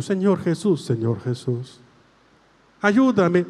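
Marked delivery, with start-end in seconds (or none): 0.8-1.8 soft echoed prayer. Shown as a demonstration. Priest models the prayer for the girl to repeat, softly. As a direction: lower and softer, like the response. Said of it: "Reza conmigo".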